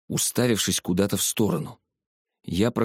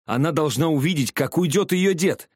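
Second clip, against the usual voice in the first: shouting; 7 semitones higher than usual. A narrator's reading. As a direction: shout it, high and eager. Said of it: "прокричал я". (радостно прокричал)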